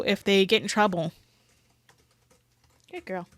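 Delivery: usual speaking voice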